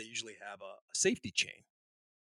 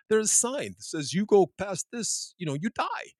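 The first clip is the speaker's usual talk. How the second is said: higher, louder, slower retold Russian; mock incredulous Russian; 7 semitones higher, 5 dB louder than usual. Russian engineer scoffs at the American safety guys. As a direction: act baffled by the fuss.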